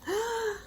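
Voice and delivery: high startled gasp; sharp gasp. Acted. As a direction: gasp in shock. Door flung open.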